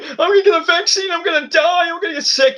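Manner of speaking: high, fast whiny mockery of scared people; squeaky mock voice sneering at anti-vaxxers. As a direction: mimic them as silly and panicky. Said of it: "all these people out there is like".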